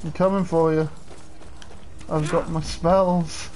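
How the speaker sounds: ordinary talk, nothing marked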